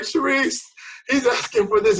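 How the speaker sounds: high, loud flustered plea; imitating yourself in a fluster; mock panic. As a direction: act out a frantic call for help. Retold panicked call to loan contact.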